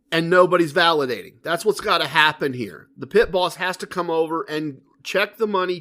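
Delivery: ordinary delivery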